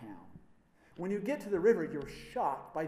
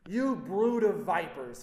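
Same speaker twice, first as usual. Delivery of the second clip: loud, slow, furious condemnation; screaming; 3 semitones higher, 7 dB louder, slower than usual. Acted. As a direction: hurl the words at the crowd. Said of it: "he screams".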